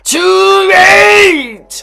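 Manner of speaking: shout. Real, not acted. Sudden outburst while watching.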